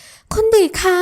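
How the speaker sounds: high, urgent call; frightened cry. Acted. Ramon rushes to fallen Adisa, crying out high. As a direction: call out to her in panic.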